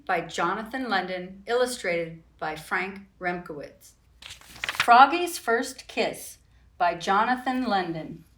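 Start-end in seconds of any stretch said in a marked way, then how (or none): none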